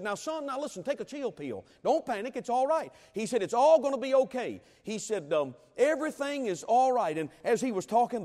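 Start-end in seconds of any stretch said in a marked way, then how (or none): 0.0-7.2 lightly higher, gentle reassurance; calm the frightened boy kindly; a hint of a fatherly pastor calming a scared scout; soothing old preacher. Preacher pats the scout's head.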